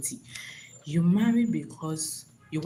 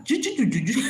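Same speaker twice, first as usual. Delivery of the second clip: mock newscaster babble; 6 semitones higher, faster than usual. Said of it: "just talking like a newscaster".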